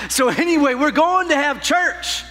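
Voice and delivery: ordinary talk, nothing marked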